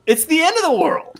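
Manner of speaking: high, incredulous pleading; mock exasperation. Exasperated imagined rebuke of Tony.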